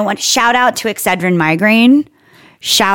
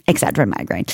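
low, hushed product sign-off; mock ad tagline; 10 semitones lower, 6 dB quieter than usual. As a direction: murmur it like a spoof commercial tag. Mock sponsor tagline closing spoof ad.

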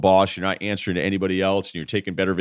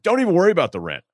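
high, quick and delighted; imitating a giddy, generous landlord; overjoyed landlord; 10 semitones higher, 3 dB louder, faster than usual. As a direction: wave it away in a thrilled, high-pitched rush. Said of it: "he was so happy".

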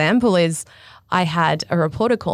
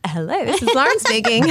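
phone-answering voice; 5 semitones higher than usual. Retold or imitated. Answers reporter's call after door slam.

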